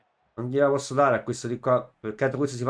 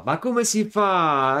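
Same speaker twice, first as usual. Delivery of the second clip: slow, higher, louder cry of disbelief; exasperated outburst; 6 semitones higher, 5 dB louder, much slower than usual. Real, not acted. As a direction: throw the question out in frustration. Frustrated gamer after miss.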